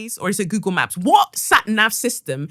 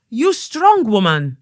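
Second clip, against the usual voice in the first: slow, louder mock-Brazilian accent; poor Brazilian accent; 4 semitones higher, 5 dB louder, slower than usual. Reception guy admiring her build, attempted Brazilian accent. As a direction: put on a bad Brazilian accent, drawn out. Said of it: "I can't do a Brazilian accent".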